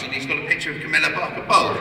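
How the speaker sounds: normal talking voice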